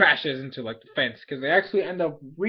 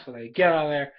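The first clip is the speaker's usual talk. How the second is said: usual speaking voice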